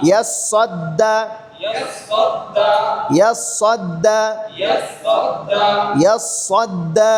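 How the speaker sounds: ইয়াস সদ্দা slow, the দ্দ held doubled; slow doubled consonant. Shown as a demonstration. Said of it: "প্রথমে সদ ডান দিক থেকে".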